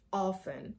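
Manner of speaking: often said softly as "off-en"; often as "OFF-un", falling; silent T. Shown as a demonstration. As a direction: say often with no T at all. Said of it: "the T is silent here".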